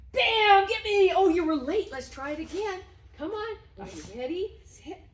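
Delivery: very high, loud playful calls; shrill game-time cheer; excited urging. Real, not acted. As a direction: egg the dog on to catch you. Playing reward game with dog.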